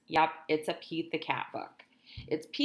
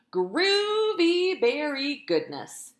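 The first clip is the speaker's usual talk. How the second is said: higher, louder, slow proud announcement; proud Pete; 8 semitones higher, 6 dB louder, much slower than usual. Acted. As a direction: present it like a prize. Pete proudly names treat.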